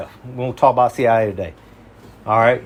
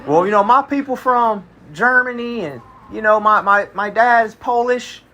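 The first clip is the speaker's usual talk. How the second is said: higher, mimicked excuse-making; imitating backpedaling coworker; 7 semitones higher than usual. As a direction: mimic him trying to clean it up, pitched up. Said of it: "he kinda tried to clean it up".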